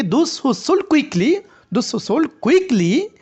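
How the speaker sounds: "quickly" lifted high with a rising end; slow demo, "quickly" climbing; rising intonation on quickly. Shown as a demonstration. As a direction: raise "quickly" apart from "sold". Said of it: "কুইকলিকে একটু rising করতে হবে".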